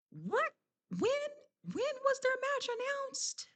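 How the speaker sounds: very high-pitched, halting confusion; baffled disbelief. Real, not acted. Vlogger asking viewers about an unannounced match, high and baffled. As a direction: stumble over the question as if you missed something.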